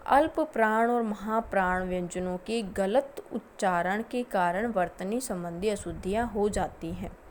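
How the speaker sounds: ordinary talk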